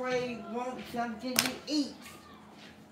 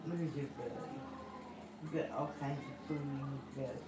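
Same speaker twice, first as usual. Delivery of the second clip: much softer and lower, cooing; 7 semitones lower, 9 dB quieter, much faster than usual. Real, not acted. Cooing at the baby about its belly, hushed.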